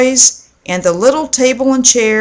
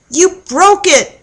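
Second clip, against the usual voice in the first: accusing call; 9 semitones higher than usual. A narrator's reading. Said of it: "she called to Russell".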